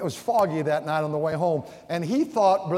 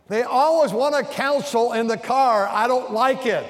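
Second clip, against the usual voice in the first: mock complaint; 7 semitones higher, 6 dB louder than usual. Friend complains about riders.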